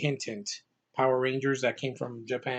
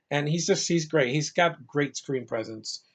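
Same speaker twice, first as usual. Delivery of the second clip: usual speaking voice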